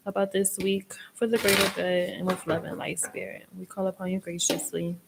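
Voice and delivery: hushed, reverent prayer to Spirit. Acted. Reader calling on Spirit before pulling cards, hushed and reverent. Invoking spirit in tarot reading.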